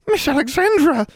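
very high, loud elf shriek; shrieking house elf. A narrator's reading. House elf shrieking at Alexandra in panic, very high. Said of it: "Triss practically shrieked".

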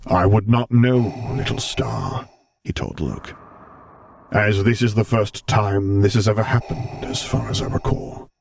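measured and grave, a touch amused; the Vader voice, held steady; Vader's voice. Acted. Vader's voice held across lines.

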